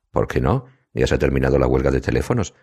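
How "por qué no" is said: usual speaking voice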